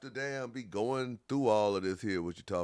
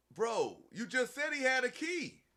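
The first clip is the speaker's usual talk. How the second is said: much higher, catching a lie; pitched-up gotcha; incredulous callout; 13 semitones higher, 4 dB quieter than usual. Real, not acted. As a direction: point out the contradiction in disbelief.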